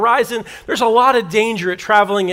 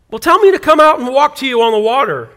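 calling out; 4 semitones higher, 6 dB louder than usual. Acted. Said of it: "Peter called out to him".

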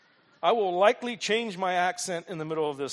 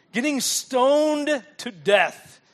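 louder, slow and weighty, every word stressed; drawn-out emphasis; 6 semitones higher, 7 dB louder, much slower than usual. Real, not acted. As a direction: let each word land like a stone. Preacher makes them picture stoning.

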